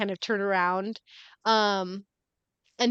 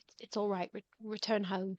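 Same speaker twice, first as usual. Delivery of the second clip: much softer, soothing priest voice; priest reassuring; 10 dB quieter than usual. Acted. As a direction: gently send the worshippers home. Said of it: "and he's like".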